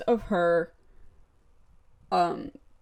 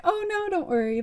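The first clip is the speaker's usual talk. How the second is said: high, sing-song mock mom voice; put-on motherly reassurance; reassuring mom; 10 semitones higher, 3 dB quieter than usual. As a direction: imitate a mother soothing her kid, pitched up. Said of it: "And the mom's like".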